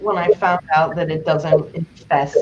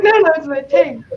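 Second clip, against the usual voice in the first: frantic; 10 semitones higher, 3 dB louder than usual. Retold or imitated. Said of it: "I was like".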